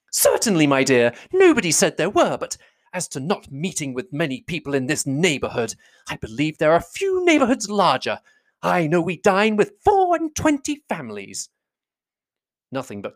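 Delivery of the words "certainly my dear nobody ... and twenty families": high, huffy, self-important mother; high-pitched insistence, slowing grandly at the end; affronted matron. A narrator's reading. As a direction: defend your neighbourhood with wounded pride. Mrs Bennet defends the neighbourhood.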